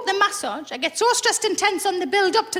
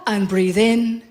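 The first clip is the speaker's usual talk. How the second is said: much lower, soothing instructor voice; masseuse breathing cue; 9 semitones lower, much slower than usual. Retold or imitated. Masseuse teaching her client to breathe, lowered and soothing. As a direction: guide the breath in slowly. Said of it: "They go".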